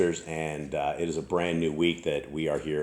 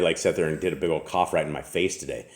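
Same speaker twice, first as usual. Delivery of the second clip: normal talking voice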